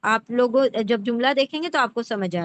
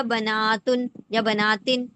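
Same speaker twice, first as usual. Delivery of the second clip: بناتن said slowly, the aat ending drawn out; teacher slowing down on بناتن; slow demo pronunciation; slower than usual. Shown as a demonstration. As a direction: stretch بناتن so students hear the plural ending. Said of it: "اس کا plural کیا ہوگا؟".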